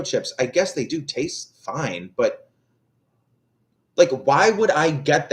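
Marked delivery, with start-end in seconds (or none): none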